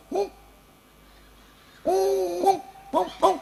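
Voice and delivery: high yelping barks like a dog; father barks at the window to scare the child; mock dog barks. Father fakes a dog outside to scare the child, high yelping barks. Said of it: "bapaknya dianjing-anjingin".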